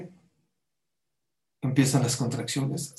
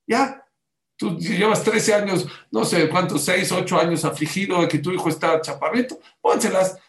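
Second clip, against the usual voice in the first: raised, louder, impatient persuasion; insistent urging; 4 semitones higher, 5 dB louder than usual. Retold or imitated. Doctor tells worried parent to use them, insistent urging. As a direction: push them firmly to go ahead.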